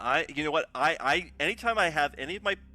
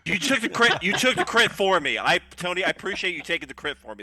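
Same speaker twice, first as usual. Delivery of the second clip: sarcastic gratitude; 3 semitones higher, 6 dB louder than usual. Acted. Mock gratitude for taking crit.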